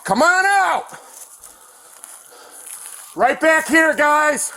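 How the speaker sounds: high, loud command call; shouted surrender demands. Real, not acted. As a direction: yell it out, urgent. Officer calling suspect out.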